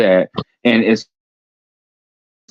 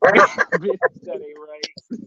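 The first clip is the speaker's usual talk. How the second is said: laughing; 3 dB quieter, much slower than usual. Real, not acted. Laughing at friend's joke.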